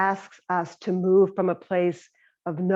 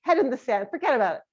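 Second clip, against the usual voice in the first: high, airy, dismissive voice; hammed-up breezy ostrich brushing it off; flighty ostrich; 6 semitones higher than usual. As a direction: wave the problem off, flighty and fast. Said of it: "And the ostrich".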